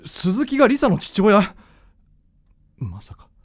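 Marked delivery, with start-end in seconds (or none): 2.8-3.3 shocked whisper. Acted. Son realizes in shock, whispered.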